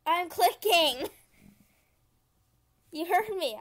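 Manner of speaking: loud, high-pitched protest; exasperated shout. Real, not acted. Player telling the game they are clicking, exasperated shout. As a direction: insist loudly at the unresponsive game.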